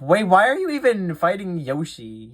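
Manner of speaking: high-pitched, baffled objection; incredulous protest. Real, not acted. Game host objecting to an attack on Yoshi, incredulous.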